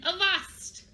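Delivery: high-pitched pirate call; attention-getting call. Shown as a demonstration. Teacher asking children to repeat the attention call, calling out. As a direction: lead children in hailing the crew. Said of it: "Say that with me again".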